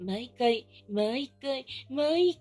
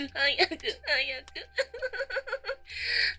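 mocking laugh; 6 semitones higher, 3 dB louder than usual. After urging faster.

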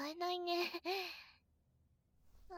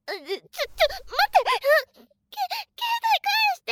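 panicked shout; 13 semitones higher, 10 dB louder than usual. Real, not acted. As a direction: beg for the phone back, panicking. Grabbing for phone.

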